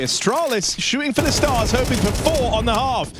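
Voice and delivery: hyped caster, pitch soaring on the big play; live play-by-play. Acted. Caster hypes Magisk's kills to late viewers, rising play-by-play. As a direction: sell the play of a lifetime. Casting the final round.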